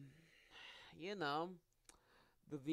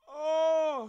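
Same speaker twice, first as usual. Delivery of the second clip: high, loud sudden "oh"; surprised; 11 semitones higher, 15 dB louder than usual. Real, not acted. Streamer noticing a video, sudden surprised cry. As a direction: blurt it out, caught off guard. Spots something on screen.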